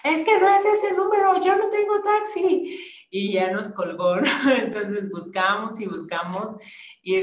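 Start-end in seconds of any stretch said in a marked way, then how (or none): 0.0-2.8 very high, loud retold yelling; mock yell from the annoyed caller; imitated shout. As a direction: shriek it back as the angry woman. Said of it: "hasta nos gritó".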